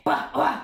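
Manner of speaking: simple mid-pitch bark; medium dog bark. Shown as a demonstration. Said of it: "À peu près à la hauteur de ta voix parlée".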